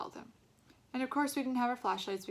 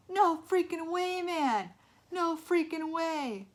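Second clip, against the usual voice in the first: shocked yelling; 9 semitones higher, 9 dB louder, much slower than usual. A narrator's reading. Kids shocked by face.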